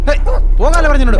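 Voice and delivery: loud, high shout to scare dogs off; shooing shout. Acted. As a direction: shout them away in alarm. Shouting to shoo off chasing dogs. (ഉറക്കെ ആട്ടിയോടിക്കുന്ന വിളി)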